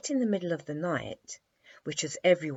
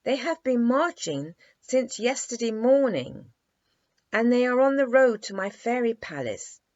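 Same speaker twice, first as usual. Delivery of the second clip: put-on raised, bright child's voice; a lightly voiced boy explaining eagerly; 9 semitones higher, 8 dB louder than usual. A narrator's reading. The prince explaining his soldiers to Dimples, raised eager voice. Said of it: "explained the prince".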